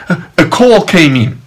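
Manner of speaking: ordinary delivery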